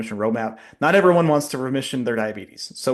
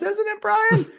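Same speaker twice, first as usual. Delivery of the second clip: squeaky, much higher mock-indignant question; mock indignation; slower than usual. Teasing Brian about doctors' bonuses, squeaky mock indignation. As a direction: pitch it way up in fake outrage.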